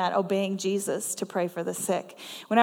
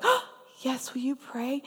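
high, gasped acceptance, then softer; touched surprise; 3 semitones higher, 4 dB quieter than usual. Retold or imitated. Elderly lady accepting a stranger's prayer, touched surprise. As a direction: imitate someone surprised and grateful to be asked.

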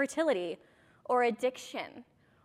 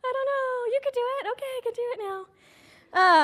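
higher, mock-reluctant voice that perks up; imitating herself; 9 semitones higher than usual. She mimics her past self being coaxed by Jackie, higher. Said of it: "And I'd be like".

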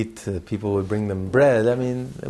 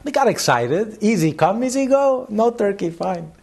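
a touch of a breezy dean, higher and louder; wise dean; 9 semitones higher, 3 dB louder than usual. Retold or imitated. Dean answering the rich donor, breezy and higher. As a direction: toss it off lightly, higher and a little louder. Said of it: "he says".

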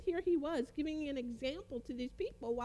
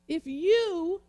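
loud, high, drawn-out "you"; stress on you; 5 semitones higher, 9 dB louder than usual. Shown as a demonstration. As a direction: make "you" ring out like a proper name. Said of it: "proper noun".

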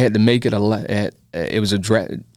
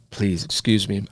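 ordinary delivery

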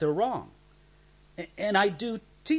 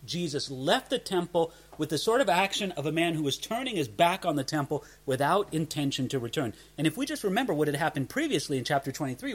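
ordinary talk, nothing marked

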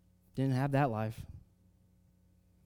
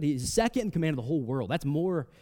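ordinary talk, nothing marked

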